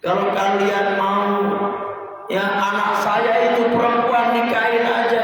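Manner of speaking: high, desperate begging, slowed; panicked pleading. Acted. Lot begs the mob to spare his guests, panicked. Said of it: "Sampai ngomong begini".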